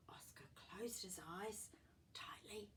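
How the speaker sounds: hushed storybook whisper; suspenseful whisper. A narrator's reading.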